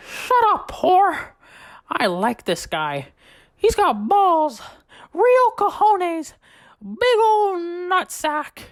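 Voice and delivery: high squeaky slurred Mickey voice, drawn out at the end; drunk Mickey Mouse. Retold or imitated. Drunk Mickey praising the new guy, high squeaky slur. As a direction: squeal it like a hammered cartoon mouse. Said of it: "he opens his mouth and he says".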